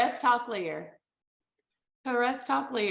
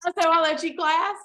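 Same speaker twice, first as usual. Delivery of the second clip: quick Southern read, a bit louder; fast Southern accent; 5 semitones higher, 4 dB louder, faster than usual. Pretend. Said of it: "but read it faster".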